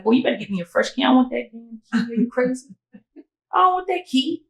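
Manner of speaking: quick, high-pitched telling-off; indignant demand. Retold or imitated. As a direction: reenact a sassy demand, climbing in pitch. Acted demand for the key.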